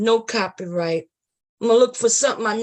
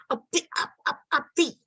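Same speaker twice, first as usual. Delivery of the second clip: usual speaking voice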